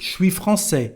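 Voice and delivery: "Je suis" fused into "chui" before "français"; casual "chui français" for "Je suis français"; spoken contraction. Shown as a demonstration. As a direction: contract "Je suis" to one sh syllable. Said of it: "we say je suis when we speak".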